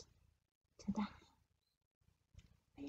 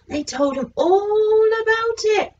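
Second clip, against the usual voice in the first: usual speaking voice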